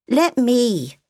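put-on high, slow small-child voice; a curious little girl asking; little girl's voice. A narrator's reading. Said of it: "Sophia said".

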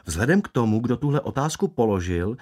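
usual speaking voice

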